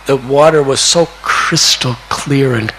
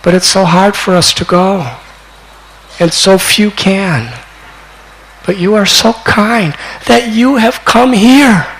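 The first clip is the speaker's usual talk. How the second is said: high, earnest plea building in volume; fervent acted gratitude; 7 semitones higher than usual. East Bengal's people thanking Nimai for coming, fervent acted gratitude. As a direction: start pleading, rise to heartfelt thanks. Preacher voices grateful crowd.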